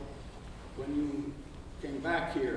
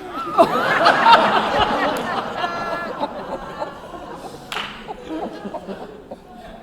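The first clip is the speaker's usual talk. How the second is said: long, loud, high burst of laughter; real laughter; 13 semitones higher, 10 dB louder than usual. Real, not acted. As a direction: crack up at the joke. Courtroom laughs at a joke.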